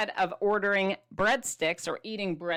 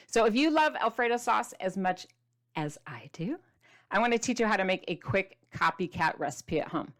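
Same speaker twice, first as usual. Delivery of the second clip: normal talking voice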